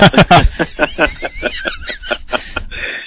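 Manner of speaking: cracking up at his own story; big laugh. Real, not acted. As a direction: laugh hard at the joke.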